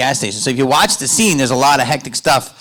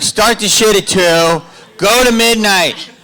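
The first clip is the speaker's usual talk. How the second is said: loud, high comic hollering; hammed-up, high-pitched rallying shout; mock rant; 10 semitones higher, 6 dB louder than usual. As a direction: holler it out at the crowd.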